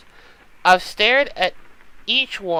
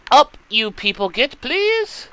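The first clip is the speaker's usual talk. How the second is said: calling out; 6 semitones higher, slower than usual. Acted. Matron calling the girls from the hallway.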